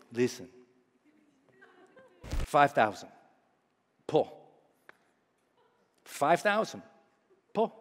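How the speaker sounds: low, soft instructor voice; quiet, deliberate imitated instruction; terse instructor. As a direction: say it low and sparse, with long pauses. Instructor's terse gestured instruction, acted.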